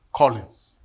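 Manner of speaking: usual speaking voice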